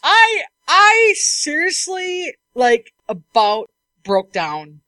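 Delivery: drawn-out, slow exasperated groan of words; slow, worn-out exasperation. Real, not acted. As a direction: stretch it out like you're at the end of your rope. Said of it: "I was so frustrated".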